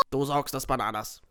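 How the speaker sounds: ordinary delivery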